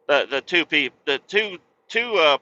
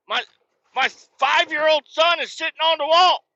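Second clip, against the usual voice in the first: retold furious yelling; 7 dB louder than usual. Dad screaming at lifeguard Bryson, hammed-up rage. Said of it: "You know".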